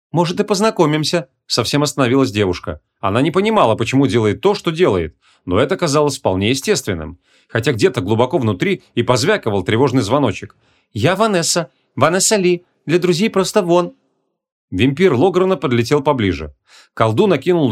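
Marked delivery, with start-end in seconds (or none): 0.1-1.3 higher, entranced reply; enchanted girl. A narrator's reading. Enchanted girl yields to the sorcerer. 10.9-13.9 higher-pitched, spellbound introduction; enchanted girl. A narrator's reading.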